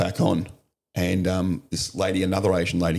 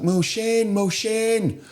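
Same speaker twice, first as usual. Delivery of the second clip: much higher, pleading repeated call; imitated desperate cry; much slower than usual. Injured man calls for his mother to the officer, pleading. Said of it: "He's like".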